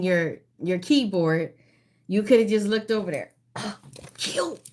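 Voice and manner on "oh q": gasping realization. Real, not acted. Host's amused reaction to keyboard answer.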